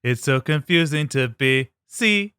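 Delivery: lightly hammed-up pop star drama; campy diva line. Echoes the diva bit.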